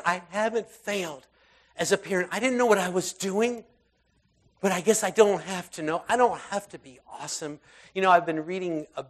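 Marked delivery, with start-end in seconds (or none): 0.1-7.6 joyful relief. Retold or imitated.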